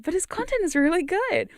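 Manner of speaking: high-pitched, insistent surprise; shocked-sounding praise. Real, not acted. Streamer recommends a friend's audios, sounding shocked. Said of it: "I sound shocked when I say that".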